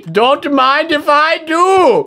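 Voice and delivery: slow, high, savoring line; hammed-up delight. Real, not acted. Grabbing honey, hammed-up delight. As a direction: relish it grandly.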